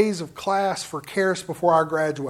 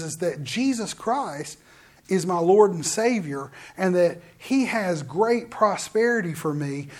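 ordinary delivery

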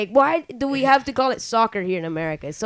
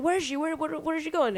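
higher, frantic repeated questions; frantic mock questions; 7 semitones higher, 5 dB quieter than usual. Podcaster acting the robbed hotel guest, frantic mock panic. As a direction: ask it in a panic, looking around. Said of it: "He's like".

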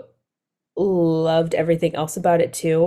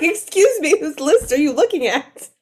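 incredulous high voice; 9 semitones higher than usual. Retold or imitated. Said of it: "It's like".

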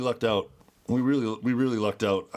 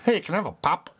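silly quoting voice; 8 semitones higher than usual. Retold or imitated. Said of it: "This sounded stupid".

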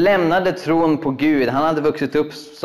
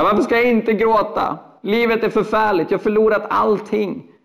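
despairing refugee; 7 semitones higher than usual. Retold or imitated.

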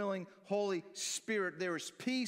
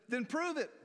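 high, emphatic challenge; 8 semitones higher than usual. Acted. Jesus answers Peter's claim of love, challenging. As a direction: throw it down as a dare, pitched up.

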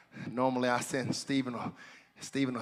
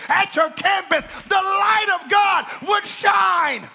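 loud, high-pitched shouted exhortation; fervent pulpit cry, stretching key phrases; full-force preaching; 8 dB louder, slower than usual. Real, not acted. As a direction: build to a shouted command.

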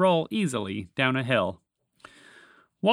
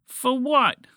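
high, loud, disbelieving question; incredulous; 12 semitones higher, 6 dB louder than usual. A narrator's reading. Rescued frog asked to be grateful, incredulous. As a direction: ask it as if it's absurd. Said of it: "Walter asked incredulously".

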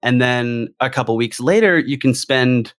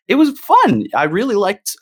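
bursting with delight, much higher and louder; excited; 9 semitones higher, 3 dB louder than usual. Real, not acted. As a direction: gush about how fun it was. Gushing about a favorite game mode.

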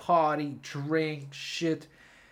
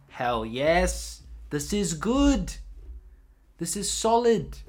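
higher, louder, slow delighted cheer; excited; 5 semitones higher, 5 dB louder, slower than usual. Retold or imitated. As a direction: savor each word like great news. Excited about Bam's recovery.

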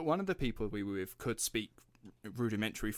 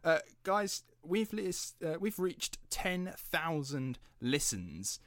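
proud announcement; 7 semitones higher, much slower than usual. Real, not acted. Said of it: "congratulations to us".

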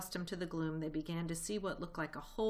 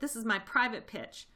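indignant rat; 5 semitones higher, 5 dB louder than usual. A narrator's reading. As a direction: voice raised in protest. Rat squabbles over his patch.

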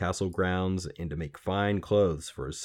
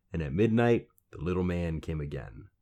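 ordinary delivery

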